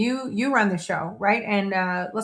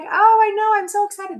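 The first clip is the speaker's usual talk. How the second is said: mock excited reply; 12 semitones higher, 5 dB louder than usual. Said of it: "they wouldn't be like".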